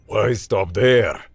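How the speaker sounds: put-on Russian accent. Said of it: "Boris interrupted".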